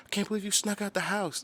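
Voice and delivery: high, scolding parent voice; mock parent. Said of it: "they're like".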